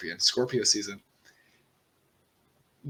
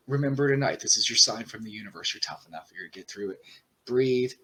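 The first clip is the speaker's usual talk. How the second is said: ordinary delivery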